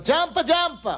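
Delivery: mock yell up. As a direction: firemen calling up to the man on the ledge, a mild mock yell. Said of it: "Fireman yelling".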